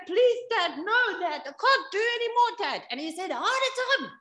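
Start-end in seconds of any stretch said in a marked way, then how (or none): 0.1-2.8 put-on high-pitched, pleading child; tearful begging. Son seeing welts on dad's back, tearful begging. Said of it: "crying, begging his dad". 3.3-4.1 strained insistence. Retold or imitated. Dad overriding his son's pleas, strained high insistence. Dad demands more despite pleading.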